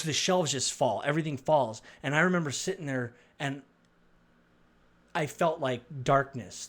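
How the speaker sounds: ordinary delivery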